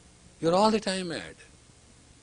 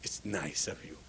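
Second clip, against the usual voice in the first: much softer and lower, gracious; a calm grateful reply; gentle thanks; 11 semitones lower, 11 dB quieter than usual. Shown as a demonstration. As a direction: thank them quietly without reacting.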